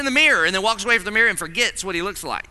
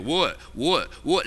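lower, lightly voiced grunting preteen boy; sixth-grade boy; 3 semitones lower, slower than usual. Sixth-grade son answering his sister, low mock boy voice. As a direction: imitate a boy's one-word replies. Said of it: "he's in the sixth grade, and he's a dude".

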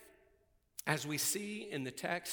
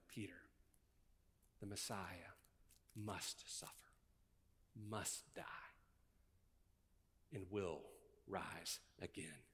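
low, much softer, half-whispered; intimate whisper; 7 semitones lower, 13 dB quieter than usual. Retold or imitated. Jesus confiding to Peter, soft whisper. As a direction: lean in and whisper it to him.

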